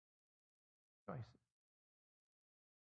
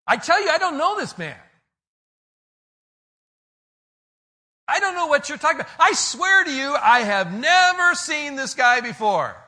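desperate denial; 20 dB louder than usual. Acted. Peter at the trial denying he knows Jesus, yelling. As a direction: swear it off in a frantic yell.

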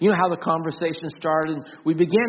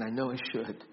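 softer, lower, fading to almost nothing; fading retold refusal; 7 semitones lower, 14 dB quieter than usual. Pastor retelling the addict's refusal, fading into a near whisper. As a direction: let the man's refusal die away on each repeat. Repeating the man's answer, fading.

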